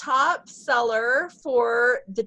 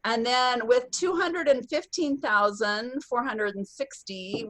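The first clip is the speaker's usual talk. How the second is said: ordinary delivery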